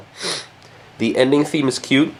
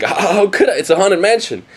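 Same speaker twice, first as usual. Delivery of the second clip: lightly mimicked higher-pitched protest; imitated brother's protest; 7 semitones higher than usual. Brother begged by sister to go, lightly mimicked protest. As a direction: protest in a mildly hammed-up higher voice. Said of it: "He's like".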